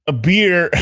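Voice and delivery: ordinary delivery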